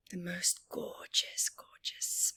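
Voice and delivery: soft whisper savouring the smell; hushed, dreamy whisper. Acted. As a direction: breathe the words as if smelling incense.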